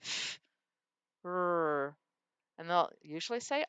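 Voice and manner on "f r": "F-" and "r" said as separate sounds; split sounds. Shown as a demonstration. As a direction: separate "F-" from "r" with a pause. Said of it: "split it and say".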